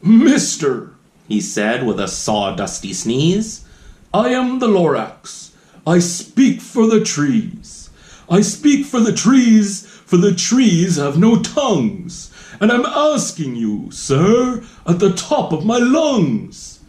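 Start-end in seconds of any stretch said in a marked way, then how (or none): 0.0-0.9 loud, bossy little creature calling out; sharpish, bossy Lorax. A narrator's reading. Small mossy Lorax scolding the Once-ler, bossy and sharp. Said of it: "spoke with a voice that was sharpish and bossy". 4.1-16.8 higher, bossy creature voice, louder in the middle; a cranky little guardian lecturing firmly; sharpish, bossy Lorax. A narrator's reading. Lorax demanding the Once-ler listen, sharp and bossy. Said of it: "spoke with a voice that was sharpish and bossy".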